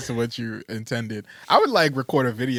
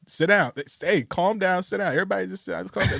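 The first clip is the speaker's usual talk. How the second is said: mock calming orders; 7 semitones higher than usual. Imagined video calming funeral guests.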